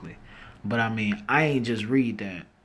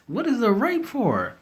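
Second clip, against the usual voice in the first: incredulous; 11 semitones higher than usual. Real, not acted. Incredulous follow-up question.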